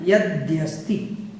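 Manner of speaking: यद्यस्ति slow and soft with a rise, द्य joined; a quiet model of यद्यस्ति; careful pronunciation. Shown as a demonstration. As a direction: draw out यद्यस्ति, keeping द and य fused. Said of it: "द और या यहाँ पर मिला हुआ है".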